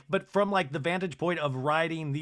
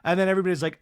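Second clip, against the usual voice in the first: usual speaking voice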